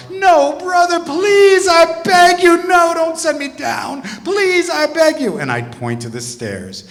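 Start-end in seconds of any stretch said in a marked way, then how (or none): 0.1-5.4 high, loud mock wail; imitating a desperate student wailing; wailing plea. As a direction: beg in a drawn-out, hammed-up wail. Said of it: "the wailing would start".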